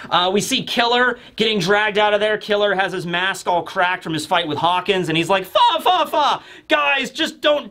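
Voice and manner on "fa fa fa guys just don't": high, strained wheeze of a hurt man; voicing the hurt masked fighter, pained and high; strained high squeak. Voicing injured Killer.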